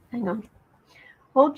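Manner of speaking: usual speaking voice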